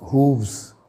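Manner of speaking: "हूव्स" said with a long oo and a final vz; teacher's model pronunciation of "हूव्स"; pronouncing hooves. Shown as a demonstration. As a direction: model "हूव्स" slowly after spelling it. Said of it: "H double O F S".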